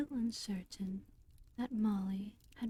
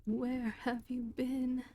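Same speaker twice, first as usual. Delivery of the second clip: higher, slow, drawn-out scold; scolding cry; 4 semitones higher than usual. Acted. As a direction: scold her like a strayed cow, pained. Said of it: "scolding her".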